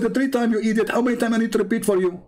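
high fast irritated mimic; imitating Abdul, annoyed. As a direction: scold like the impatient caller. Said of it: "He said".

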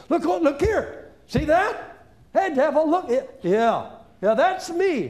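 high, gleeful jeering; taunting the devil. Pretend. Preacher taunting the devil with scripture. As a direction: gloat at him.

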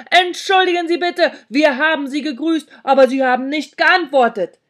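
loud, high shout for the hard of hearing; loudly shouted excuse; shouting back. A narrator's reading. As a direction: yell the apology so a deaf person hears. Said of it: "schrie ich zurück".

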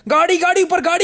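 high-pitched alarmed callout; real in-game alarm; urgent warning. Real, not acted. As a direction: call out the danger to your squad, pitch jumping up. Sudden warning of enemy car.

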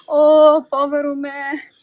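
loud, high wail of self-pity; theatrical lament at full voice; despairing cry. A narrator's reading. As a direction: cry out in dismay, drawing out the words. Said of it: "gridò Pinocchio".

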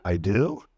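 "I do?" starting low, rising sharply on DO; stress on DO. Shown as a demonstration. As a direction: swing DO upward into a question. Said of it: "four different stress patterns".

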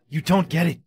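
loud, high-pitched begging; frantic, insistent appeal; desperate plea. Acted. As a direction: shout to be believed as they take you. Pleading while being arrested.

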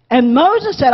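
usual speaking voice

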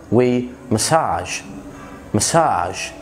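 مساج stretched slowly, stress on the second syllable; massage. Shown as a demonstration. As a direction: draw out مساج with a long final a. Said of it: "أول كلمتين معانا كلمة مسج".

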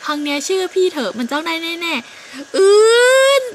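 slow, loud strained push; straining effort. Acted. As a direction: grunt through the effort, slowly. (เค้นเสียงดังช้าๆออกแรงสุดตัว)

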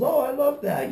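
high-pitched mock gushing; mimicked delighted customer.

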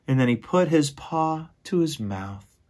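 ordinary delivery

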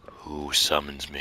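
very low, ominous summoned-being voice; mock-solemn deep demon voice; summoned spirit. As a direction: drop deep and intone it softly.

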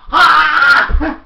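a loud single barking laugh; old man gloating with a loud laugh; triumphant laugh shout. Acted. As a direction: crow a victorious "Ha!". Grandpa triumphant mid-fight.

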